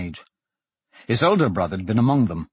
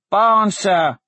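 put-on high, raised little boy's voice; small voice; 10 semitones higher, 6 dB louder than usual. A narrator's reading. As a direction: pipe up like a small boy reporting. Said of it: "a small voice said".

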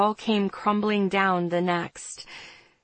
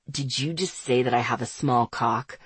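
lower, smooth male voice; Andre's voice; 8 semitones lower than usual. A narrator's reading. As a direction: read him low and cool.

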